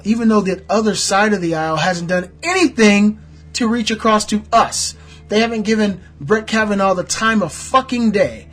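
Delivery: loud, high, heated rant; real indignation at full voice; angry tirade. Real, not acted. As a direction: build into a furious political outburst. Heated rant about other party.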